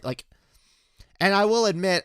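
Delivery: ordinary delivery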